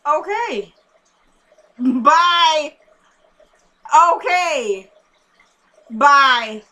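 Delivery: loud, high, drawn-out goodbye; real exasperated phone sign-off; impatient sign-off. Real, not acted. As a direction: hurry a caller off the phone emphatically. Cutting off an interrupting phone caller.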